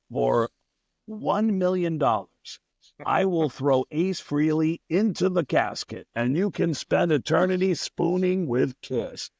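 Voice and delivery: a pushy rock star selling junk on air. Retold or imitated. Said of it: "interrupt the music guests as [name]".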